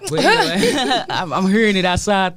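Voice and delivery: laughing at his own voice; chuckling through the words. Real, not acted. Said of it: "It sound crazy".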